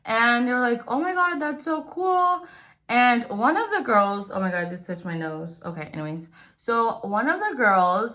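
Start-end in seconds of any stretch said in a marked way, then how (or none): 0.9-2.5 high, quick gushing schoolgirl quote; gushing excitement. Retold or imitated. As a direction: squeal it like it's the coolest news. Classmates gushing over new boy.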